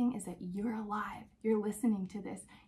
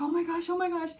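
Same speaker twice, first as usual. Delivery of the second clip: higher, breathless repeated exclamation; overwhelmed new mother; mother overwhelmed by her newborn, higher; emotional awe; 8 semitones higher, 4 dB louder, faster than usual. Retold or imitated. Meeting baby, overwhelmed.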